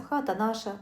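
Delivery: usual speaking voice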